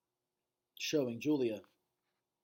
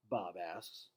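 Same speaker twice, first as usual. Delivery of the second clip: plain reading voice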